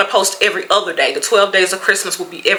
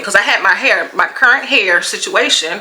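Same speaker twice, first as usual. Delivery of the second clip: ordinary talk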